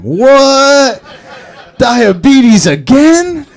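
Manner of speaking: high, loud, drawn-out outcry; mock shocked disbelief. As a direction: play a gangster's stunned reaction, way up high. Acted gang members' shocked reaction.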